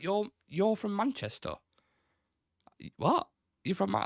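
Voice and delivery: high, incredulous quoted question; mock disbelief. Friend doubting he's from Manchester, in mock disbelief. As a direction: sound stunned he's from Manchester.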